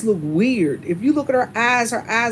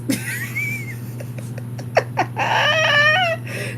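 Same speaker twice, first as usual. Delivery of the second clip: high crying laugh. Real, not acted. Cracking up at her own impression.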